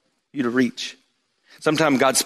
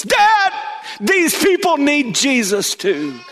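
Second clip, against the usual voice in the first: high, slow, tearful plea; a sobbing boy making his point; crying child; 11 semitones higher, much slower than usual. Retold or imitated. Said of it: "Caleb was sitting on the stands crying".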